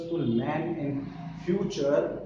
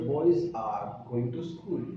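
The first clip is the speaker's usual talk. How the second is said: beginner's English accent; 5 semitones lower than usual. Shown as a demonstration. Teacher showing learners a starting accent, beginner's careful English accent. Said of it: "एक starting में एक accent है आपका".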